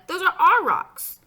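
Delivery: loud, high, slow objection; drawn-out indignant yell; indignant protest. A narrator's reading. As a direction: shout it like a kid defending turf. Boy protests over his rocks.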